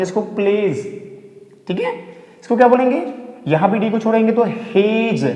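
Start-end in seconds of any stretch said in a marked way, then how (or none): none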